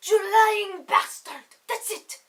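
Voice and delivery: hammed-up angry yell at a liar; mock furious accusation; mock outrage. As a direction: play the betrayed diva, loud and fast. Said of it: "I was like".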